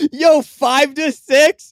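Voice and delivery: high, slow, incredulous drawl; mock disbelief. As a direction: stretch it out like you can't believe the score. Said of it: "making fun of the score being five to six".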